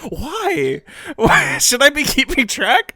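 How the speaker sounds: high, incredulous mock puzzlement; baffled confusion. Recalling first seeing timestamps, high baffled mock questioning. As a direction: act thrown by the pop-up timestamps. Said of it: "it's like".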